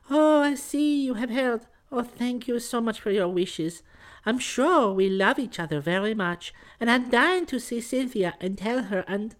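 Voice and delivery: very high, gushing falsetto; blushing young woman. A narrator's reading. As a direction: gush shyly in a girlish voice. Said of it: "Then, blushing furiously".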